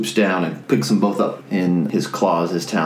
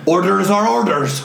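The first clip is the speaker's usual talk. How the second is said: high, insistent dwarven call; dwarf; 9 semitones higher than usual. Acted. Fleeing dwarf excusing himself to adventurer, high dwarven call. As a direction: declare it as a brusque dwarf, pitched high. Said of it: "one of the dwarves looks at you and goes".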